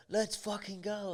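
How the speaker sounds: high hyped-up shout of a mate; excited mate. Retold or imitated. Retelling his hyped mate's early-morning cry, high and excited. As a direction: fire up like a mate at dawn.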